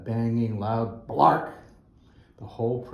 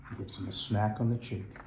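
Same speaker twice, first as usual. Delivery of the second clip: plain reading voice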